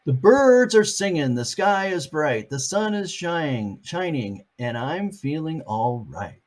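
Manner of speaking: cheerful picture book lilt; sing-song read-aloud. Acted. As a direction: read Pete's words like a little tune. Reading Pete's line to kids.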